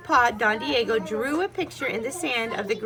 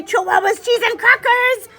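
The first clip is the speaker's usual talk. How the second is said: louder, higher excited shout; excited exclamation; 8 semitones higher, 9 dB louder than usual. Acted. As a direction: blurt it out fast. Fast exclamation in story.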